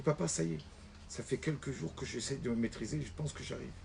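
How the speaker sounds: quiet son. Acted. Son quietly reports progress.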